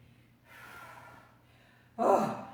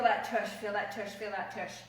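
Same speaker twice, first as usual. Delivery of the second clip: fading out; 7 semitones lower, 6 dB quieter than usual. Real, not acted. Repeats, fading after exertion.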